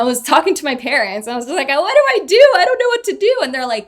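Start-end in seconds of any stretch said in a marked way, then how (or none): 1.7-3.4 much higher and louder, frantic; mock-frantic wail; panicked plea. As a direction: cry out helplessly to her parents. Panicking to her parents.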